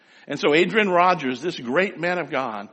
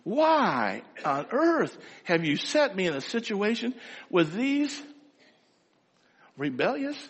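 pleading; 4 semitones higher, 6 dB quieter, slower than usual. Retold or imitated. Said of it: "pleading his case".

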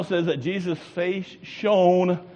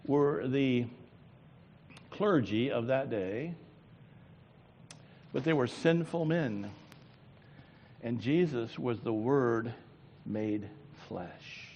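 usual speaking voice

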